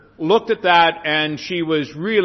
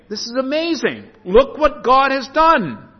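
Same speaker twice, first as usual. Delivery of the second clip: marvelling exclamation, higher and louder, slowed; amazed nations; 7 semitones higher, 5 dB louder than usual. Retold or imitated. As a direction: proclaim it in wonder like onlookers seeing a miracle. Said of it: "Nations will look on and say".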